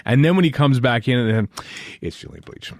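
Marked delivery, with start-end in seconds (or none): 2.0-2.7 whispered reveal. Acted. Dramatic reveal of the actor.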